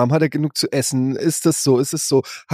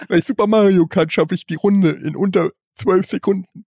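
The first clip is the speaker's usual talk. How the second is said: higher put-on nerdy voice; mock nerd boast; 7 semitones higher than usual. Nerdy teen bragging about Mario Kart times, high put-on voice. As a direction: brag like a proud gaming nerd.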